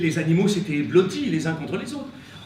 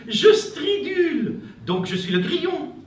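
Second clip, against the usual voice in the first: proud cricket voice; 9 semitones higher, 5 dB louder than usual. Acted. Cricket proudly declares itself.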